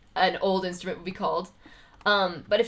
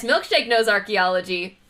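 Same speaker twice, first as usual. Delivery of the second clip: ordinary talk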